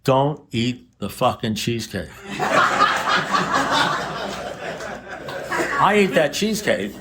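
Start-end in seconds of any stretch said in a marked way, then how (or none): 2.3-5.7 high breathy burst of laughter; real laugh. Real, not acted. Speaker laughing at his cheesecake confession, high real laugh. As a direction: laugh at your own joke, high.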